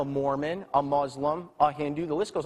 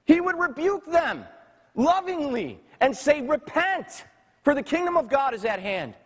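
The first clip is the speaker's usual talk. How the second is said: impassioned preaching; 7 semitones higher than usual. Real, not acted. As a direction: rail against perversion. Preaching at full force.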